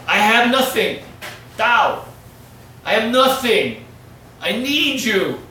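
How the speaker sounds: loud, high pleading sermon; preaching at full force. Acted. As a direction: cry out to God, begging. Preaching climax.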